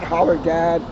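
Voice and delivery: ordinary delivery, nothing marked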